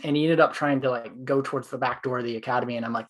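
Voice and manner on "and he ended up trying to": ordinary talk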